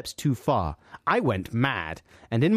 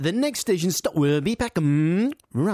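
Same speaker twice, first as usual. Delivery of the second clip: higher-pitched mimicked station announcement with a held hum; train announcer imitation; 5 semitones higher than usual. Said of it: "How does he say that?".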